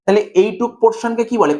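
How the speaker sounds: normal talking voice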